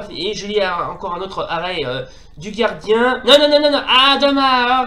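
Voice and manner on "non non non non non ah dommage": loud, higher run of no; real dismayed protest; frustrated no. Real, not acted. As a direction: cry out against the blunder. Player reacts to a mistake.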